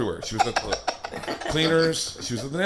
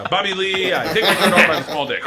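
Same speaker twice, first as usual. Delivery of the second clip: higher-pitched comic impersonation; Bobby Lee; 6 semitones higher than usual. Pretend. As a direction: introduce yourself in a put-on celebrity impression. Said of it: "Do it in honor of Bobby".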